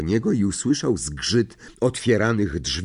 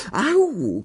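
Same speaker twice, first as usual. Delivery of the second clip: high yelp of pain; pained cry; 11 semitones higher than usual. A narrator's reading. Hermiona yelps in the dark as Ron treads on her, pained cry. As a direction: cry out sharply in a higher voice. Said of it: "krzyknęła Hermiona".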